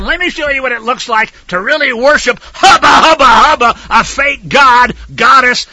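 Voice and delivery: loud, high mocking "hubba hubba"; mocking idol worshipper. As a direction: ham up a lusty pagan. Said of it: "they were basically saying".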